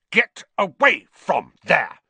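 loud, slow scolding; bossy prefect. Acted. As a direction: order them away.

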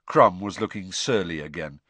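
plain reading voice

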